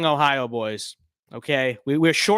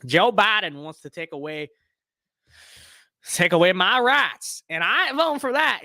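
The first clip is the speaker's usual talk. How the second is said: a hint of a Southern radio ad guy; Southern accent; 3 semitones higher than usual. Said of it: "totally thick Southern accent".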